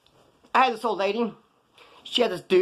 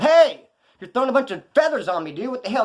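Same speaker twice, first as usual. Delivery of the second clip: high, loud retold outburst; mock yell of complaint; indignant protest; 7 semitones higher, 4 dB louder than usual. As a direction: call out in annoyed disbelief. Acted protest under flying feathers.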